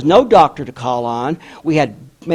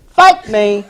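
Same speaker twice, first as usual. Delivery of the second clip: loud, raised incredulous retort; indignant echo; 6 semitones higher, 8 dB louder than usual. Retold or imitated. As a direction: throw the question back, loud and high. Retelling old midwife's indignant answer.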